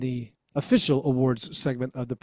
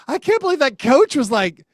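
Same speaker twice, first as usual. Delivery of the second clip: higher, louder incredulous retelling; retold disbelief; 9 semitones higher, 5 dB louder than usual. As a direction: relive the disbelief at that coach. Retelling parents' disbelief at coach.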